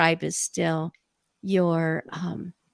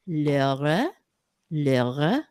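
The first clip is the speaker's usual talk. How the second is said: imitating a Norwegian grandmother saying Laura, lower, with a rising lilt; sing-song Norwegian Laura, lower voice; 5 semitones lower, slower than usual. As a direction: Norwegian granny calling Laura with a lilting rise. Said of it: "she would always say".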